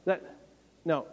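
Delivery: ordinary talk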